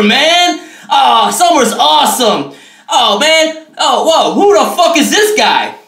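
normal talking voice

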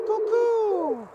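high cuckoo call; bird call. Acted. Imitates bird noise in tree.

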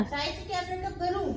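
high, soft put-on pet voice; voicing the cat. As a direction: speak for the sad cat, small and hurt.

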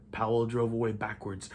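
ordinary delivery

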